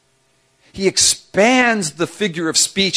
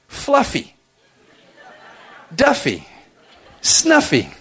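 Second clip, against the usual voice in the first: playful pet names; 6 semitones higher, slower than usual. Pretend. Inventing cute sheep nicknames.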